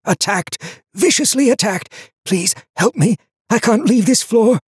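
high, strained, desperate voice; urgent strangled stage whisper; frightened hoarse whisper. A narrator's reading. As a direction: plead like a hunted survivor. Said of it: "he whispered".

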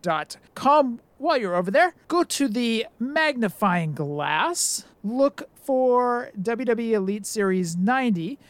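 host announcement. Acted. Host segment plug read.

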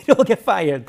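bursting into laughter mid-line; laughing. Real, not acted. Laughing at his own joke.

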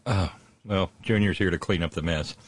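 normal talking voice

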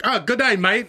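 hammed-up British tourist impression; mock British accent. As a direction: greet like a badly faked Londoner. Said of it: "in, like, a London cab".

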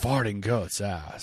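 low, slow movie-line impression; Nick Cage. Host performs a favorite movie line on request, lightly impersonating. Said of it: "in a Nick Cage voice".

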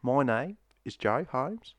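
broad, hammed-up Aussie with rising ends; Australian accent. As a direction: lay on the Australian twang thick. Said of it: "My Australian's the only other accent".

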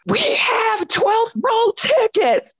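high, slow, thrilled announcement; excited news. Retold or imitated. Sister bursts down the stairs with tickets, high excited slow. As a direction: spill the big news, stretching every word up high.

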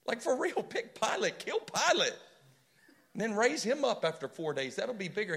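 loud, high-pitched mock pleading; exasperated protest. A believer telling Jesus whom to pick instead, exasperated protest. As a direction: argue it excitedly, voice leaping up.

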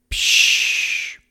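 long voiceless squirting hiss; spray out a rushing "psch"; imitated ink squirt. A narrator's reading. Happy father squid squirting ink, imitated airy hiss. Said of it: "Tinte rausgespritzt".

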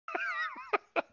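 shrill burst of laughter; cracking up at own rant, high squeal. Real, not acted.

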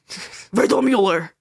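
slow, mock-angry Riddlemuller; mock angry German. Shown as a demonstration. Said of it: "You have to say it really angry".

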